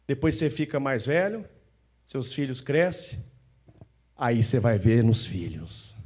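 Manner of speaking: usual speaking voice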